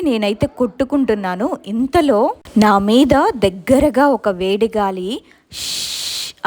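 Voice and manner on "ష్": a soft unvoiced hiss in the dark; hissing shh. A narrator's reading. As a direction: breathe out a long eerie shh. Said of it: "అని sound". (చీకట్లో వినిపించే గుసగుస "ష్" శబ్దం)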